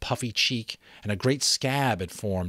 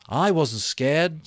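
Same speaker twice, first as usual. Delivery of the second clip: slow, higher voice of Ralph; slow denial; 8 semitones higher, 6 dB louder, slower than usual. Acted. Ralph slowly answering Piggy. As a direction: draw it out, slowly. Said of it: "said Ralph slowly".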